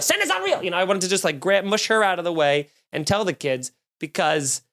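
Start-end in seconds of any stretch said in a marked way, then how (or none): 0.0-0.6 much higher, squealed Santa's not real, topping the first call; childish escalating taunt; shrieked taunt. Retold or imitated. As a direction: scream the spoiler at the kids. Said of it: "my reaction was".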